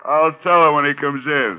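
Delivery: fake secretary voice. [name] answers his boss's call pretending to be someone else, put-on voice. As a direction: dodge the call by posing as another person.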